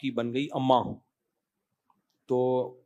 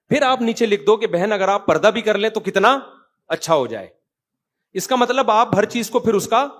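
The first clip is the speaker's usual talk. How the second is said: raised, driving sermon voice; loud impassioned lecture; forceful preaching; 6 semitones higher, 7 dB louder than usual. Acted. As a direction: hammer the point home to the crowd. Preaches to followers at full force.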